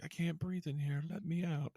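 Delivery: put-on high, soft creepy child voice; creepy kid voice. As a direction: whimper it like a trapped creepy kid. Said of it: "the creepy-ass kid voice".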